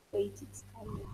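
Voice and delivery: soft whisper, barely voiced. Real, not acted. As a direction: whisper it while setting up the screen.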